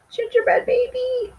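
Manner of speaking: high squeal of discovery; delighted surprise. Acted. Reader opening the house for listeners, delighted high squeal. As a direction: gasp it out like a reveal.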